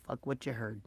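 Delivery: muttered low and soft; dropped to a low murmur; under the breath. Shown as a demonstration. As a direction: say it quietly to yourself. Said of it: "you can just say to yourself".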